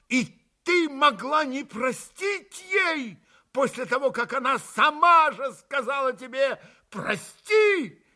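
high, loud bitter cry; bitter exclamation. A narrator's reading. Mitya bitterly exclaims at Grushenka, high and loud. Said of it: "горько воскликнул опять Митя".

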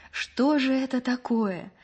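drawn-out, high-pitched bewilderment; bewildered. A narrator's reading. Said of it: "говорила растерянно Маша".

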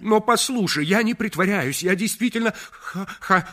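high shouted, stuttering plea; stammer, then shout it out in desperation; desperate insistence. Acted. (отчаянно крича, с заиканием)

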